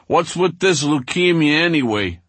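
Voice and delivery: loud, high call over a din; shouting over noise. Retold or imitated. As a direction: yell over the jail noise. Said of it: "screaming over the jailhouse din".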